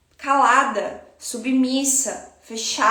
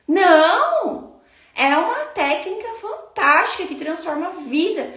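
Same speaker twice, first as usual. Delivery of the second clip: high, emphatic burst of conviction; emphatic praise; 6 semitones higher than usual. Shown as a demonstration. Teacher proudly defending her technique against false modesty, emphatic.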